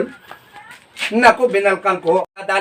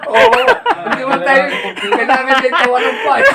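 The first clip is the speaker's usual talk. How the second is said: laughing; 8 semitones higher than usual. Real, not acted. Teller laughing at punchline.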